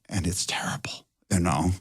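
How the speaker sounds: hushed worried whisper; a nervous whispered aside on set. Retold or imitated. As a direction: whisper it so the lookalike can't hear.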